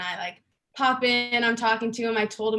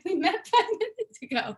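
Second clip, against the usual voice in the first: amused laughing disbelief; 9 semitones higher, 4 dB louder than usual. Retold or imitated. Same retold moment.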